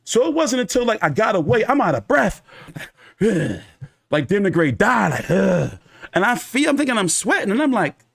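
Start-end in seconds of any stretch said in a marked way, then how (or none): none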